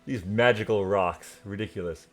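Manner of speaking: put-on loud, high, hearty lord greeting old friends; jovial noble lord. Sir Galdinger greets the party, loud and jovial. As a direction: play a booming, delighted nobleman.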